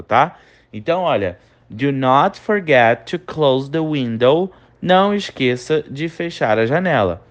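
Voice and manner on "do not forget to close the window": very slow, carefully spaced English for students to repeat; slow pronunciation model. Shown as a demonstration. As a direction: say each word slowly so the class can echo it. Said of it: "repete a pronúncia em voz alta". (leitura bem lenta para o aluno repetir)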